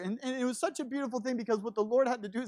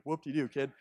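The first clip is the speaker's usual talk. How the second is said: mocking dismissal; 5 semitones lower than usual. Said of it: "young man version of first world problems".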